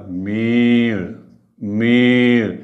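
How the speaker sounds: मीळ with a long मी and a bare ळ; clipped final l. Shown as a demonstration. Teacher showing pupils मीळ with a long मी and bare ळ. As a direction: end मीळ on a bare ळ with no vowel. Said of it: "निभृत उच्चाराचे".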